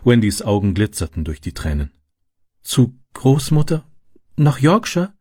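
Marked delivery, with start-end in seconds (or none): 2.6-5.1 put-on high, wondering child's question; little girl's pleased surprise; delighted. A narrator's reading. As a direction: repeat it slowly in happy disbelief. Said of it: "wiederholte sie erfreut".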